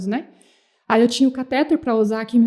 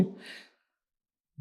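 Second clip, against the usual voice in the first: gasping breath; 20 dB quieter than usual. Retold or imitated. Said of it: "puxar um fôlegozinho só assim".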